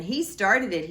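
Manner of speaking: the king blaming the dragon; indignant cry. Acted. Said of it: "he cried".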